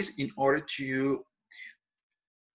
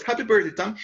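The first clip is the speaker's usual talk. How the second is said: high and louder birthday cheer; joyful greeting; 7 semitones higher, 4 dB louder, faster than usual. Shown as a demonstration. Teacher demonstrating a happy birthday wish to Tom, joyful. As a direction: sound truly glad for your friend. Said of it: "Listen to my voice".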